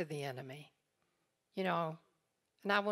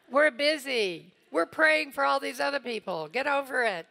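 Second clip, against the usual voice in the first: mock impatient wife; 7 semitones higher, 9 dB louder than usual. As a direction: play your own impatience for laughs. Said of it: "I'm like".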